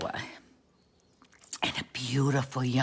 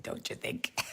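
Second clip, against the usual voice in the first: usual speaking voice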